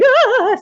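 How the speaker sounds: a sudden high loud yelp; high startled yelp. Retold or imitated. Speaker reacting to her inner trauma voice, startled yelp. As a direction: let out a shocked squeal. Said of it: "And I'm like".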